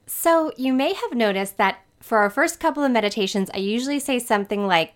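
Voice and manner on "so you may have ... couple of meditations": normal talking voice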